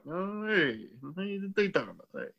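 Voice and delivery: muttering comedian impression; Rodney Dangerfield. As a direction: grumble like a put-upon stand-up. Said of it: "Master of accents over here".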